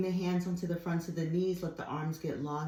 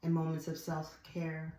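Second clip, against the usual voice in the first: ordinary delivery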